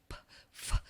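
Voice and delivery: voiceless p and f sounds, no vowel; isolated phoneme sounds. Shown as a demonstration. Teacher sounding out p, f for the class. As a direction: clip out p and f as bare consonants. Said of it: "abstrato os fonemas".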